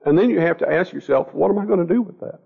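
ordinary delivery

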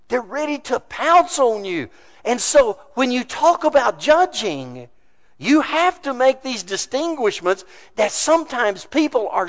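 loud, driving sermon delivery; preaching at full force. Real, not acted. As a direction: preach it hard with punched key words.

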